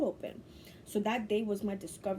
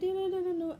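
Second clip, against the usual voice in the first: higher, excited string of no's; excited refusal; 11 semitones higher, 3 dB louder than usual. Retold or imitated. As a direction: refuse it eagerly. Refusing the dark door.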